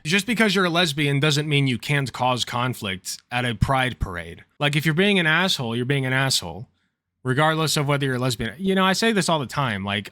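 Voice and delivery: ordinary talk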